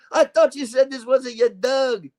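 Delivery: high, indignant inspector impression; hammed-up complaint as the film detective; Inspector Clouseau. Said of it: "Inspector Clouseau here".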